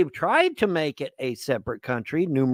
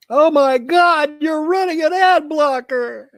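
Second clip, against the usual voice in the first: much higher and louder, dragged-out fake alarm; mock shocked website voice; 14 semitones higher, 8 dB louder, slower than usual. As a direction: play the pop-up gasping in horror, slowly. Mock voicing a website pop-up.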